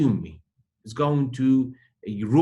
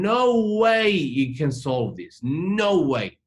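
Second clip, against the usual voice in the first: loud, drawn-out, higher-pitched refusal; stubborn refusal; 5 semitones higher, 5 dB louder, much slower than usual. Retold or imitated. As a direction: dig in and stretch it out, emphatically. Voices someone clinging to a grudge.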